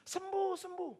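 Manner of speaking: very high, excited call; crowd cheering. Retold or imitated. Crowd cheering the healed man, very high.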